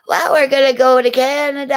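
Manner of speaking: high, loud tourist imitation; mocking tourists. Mocking tourists heading to Canada.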